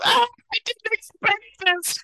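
very high, squealing mock cat yowl. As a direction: wail it like a wounded cartoon cat. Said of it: "And it's yowling".

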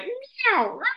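very high squeaky meow and squawk; imitated animal cry. Owl answers friends in the theater, garbled animal cry. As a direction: let a garbled animal noise slip out. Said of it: "it just comes out as like".

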